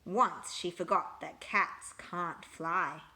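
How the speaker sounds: plain reading voice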